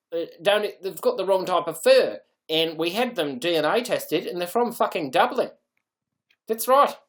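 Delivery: loud, indignant Kiwi sheep farmer; mock New Zealand twang at full volume; attempted New Zealand accent. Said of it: "Try a New Zealand accent".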